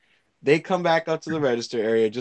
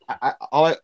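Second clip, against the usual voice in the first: normal talking voice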